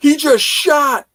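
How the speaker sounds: loud, very high imitation of a startled lookout; alarmed shout. Hunter to camp after a bang, imitated alarmed shout. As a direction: cry out the news, acting it up.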